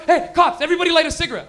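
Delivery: loud, high urgent order; panicked order. Retold or imitated. Friend panicking at the cops, loud and high.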